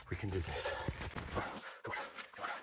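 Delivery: whispered pep talk in fear; breathless urging under breath; frightened whisper. Real, not acted. Psyching himself up mid-climb.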